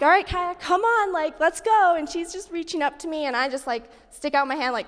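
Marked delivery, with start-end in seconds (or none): 0.0-2.0 higher, impatient urging; impatient coaxing. Retold or imitated. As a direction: hurry the toddler along, pitched up. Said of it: "So I'm like".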